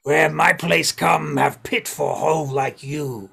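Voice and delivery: loud, slow goblin voice; sling a slow insult as a big goblin. Acted. Goblin insults the elf intruder, loud and contemptuous.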